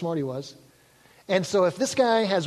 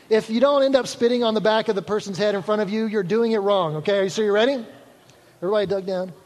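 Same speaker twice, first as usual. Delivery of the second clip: ordinary talk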